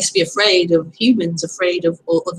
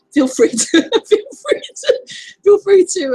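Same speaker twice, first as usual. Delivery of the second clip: giggling; 10 semitones higher, 4 dB louder than usual. Real, not acted. Restarting amid giggles.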